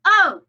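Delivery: O said loud and high; accented letter. Shown as a demonstration. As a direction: punch O. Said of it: "accent every other letter".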